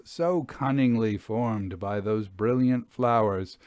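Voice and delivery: ordinary delivery, nothing marked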